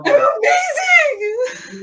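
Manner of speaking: high, slow tearful shout; crying shout. Acted. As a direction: shout it out through tears. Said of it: "Say that again".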